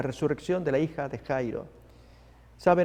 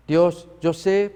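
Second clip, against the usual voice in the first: slow, earnest prayer voice, a bit louder; 6 dB louder, much slower than usual. Shown as a demonstration. Preacher demonstrating a prayer to God, slow and earnest. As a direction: pray it slowly to God. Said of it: "podemos orar de esa manera".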